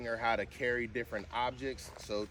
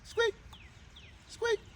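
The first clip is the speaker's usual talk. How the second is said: squeaky high command. Shown as a demonstration. Owner coaxing her dog to squeak, very high and squeaky. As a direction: squeak the word like a dog toy. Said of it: "that's what you're trying to get".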